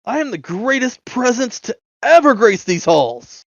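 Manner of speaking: high-pitched grandiose proclamation; indignant boasting. Acted. Braggart announces himself to the creature, grandly indignant. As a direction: proclaim it with puffed-up, offended pride.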